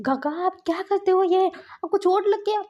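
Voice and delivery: high, loud worried outcry; acted alarm, pitch climbing sharply; alarmed concern. Nephew sees uncle's hand cut by glass, alarmed.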